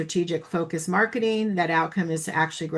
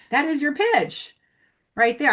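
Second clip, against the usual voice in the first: excited discovery; 8 semitones higher, 3 dB louder than usual. Retold or imitated. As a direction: spell it out as a eureka moment. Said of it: "I'm like".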